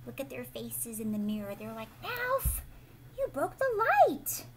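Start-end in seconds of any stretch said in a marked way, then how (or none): 2.0-4.5 scolding rabbits. Acted. Rabbits scolding Ralph in picture.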